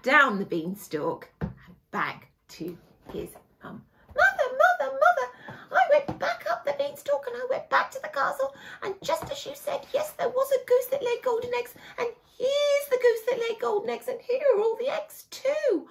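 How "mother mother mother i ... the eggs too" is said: put-on high, tearful, eager boy calling his mother; excited Jack. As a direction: burst in breathless with joy. Jack brings goose to mum.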